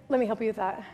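low, soft husband voice; cool husband. Acted. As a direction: offer help in a low, unbothered voice. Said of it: "He's like".

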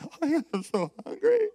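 high-pitched mock wail with a laugh; hammed-up hungry whine. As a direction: whine it as if starving. Acting out dragging himself in, starving.

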